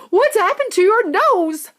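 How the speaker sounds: high, loud alarmed mother; shrieking mother. Acted. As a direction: shriek it at the daughter. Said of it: "shrieked Missus Beauregard".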